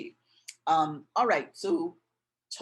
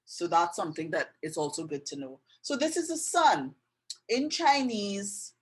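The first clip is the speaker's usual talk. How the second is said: usual speaking voice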